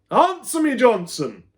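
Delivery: very high, loud furious yell; teacher yelling at a silent pupil. A narrator's reading. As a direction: yell the order. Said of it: "yelled Pratley".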